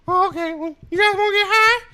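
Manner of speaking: very high, loud cartoon squeal; South Park voice. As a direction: squeak it like a stoned cartoon character. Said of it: "Do the South Park voice".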